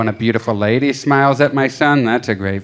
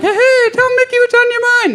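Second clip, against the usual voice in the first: very high falsetto cartoon mouse; Mickey Mouse; 5 dB louder than usual. Puppeteer dad asking his son what's up, Mickey Mouse voice. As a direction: squeak it out like a chummy puppet mascot. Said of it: "as Mickey".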